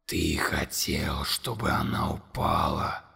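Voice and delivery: very low, slow, whispered accusation; whispered monster. A narrator's reading. Monster whispering accusation to Conor. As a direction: hiss the accusation.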